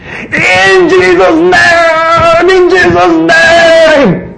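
very high, slow, loud scream; emotional screaming at the top of your lungs. Retold or imitated. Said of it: "you're screaming at the top of your lungs".

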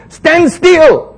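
high, loud command. Acted. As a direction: order the crowd to stand still. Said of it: "he told the people".

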